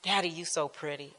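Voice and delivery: put-on very soft, little-girl sweetness; hushed childlike adoration. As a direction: coo it like a small child on a lap. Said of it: "daddy said I used to sit on his lap and say".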